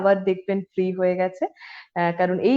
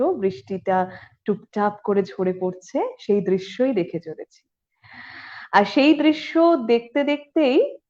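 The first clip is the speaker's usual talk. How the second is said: ordinary talk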